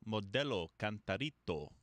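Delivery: Modelo Cantarito said with Spanish vowels and rolled sounds; Spanish pronunciation. Shown as a demonstration. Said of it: "you do it better than me".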